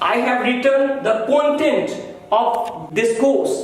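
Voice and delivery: "content" stressed on the first syllable, CON-tent, said slowly; book's contents sense; noun content. Shown as a demonstration. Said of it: "like मैं कहूँ".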